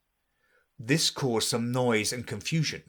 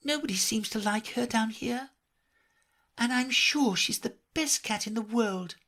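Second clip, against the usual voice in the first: put-on higher girlish voice, mildly sad; a touch of melancholy; 11 semitones higher than usual. A narrator's reading. Lonely Alice thinking of her cat, wistful. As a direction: muse sadly to yourself.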